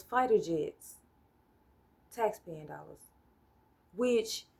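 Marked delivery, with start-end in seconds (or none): none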